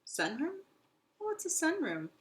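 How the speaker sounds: high rising wonder; curious hamster asking; puzzled. Acted. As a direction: puzzle over the new word.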